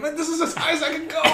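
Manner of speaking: high, quick recruit plea; mock strained protest. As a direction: plead like a struggling recruit. His inner protest during pull-up.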